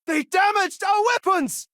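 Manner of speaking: shouted, high alarm call; combat shout. Acted. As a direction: yell it over the fight.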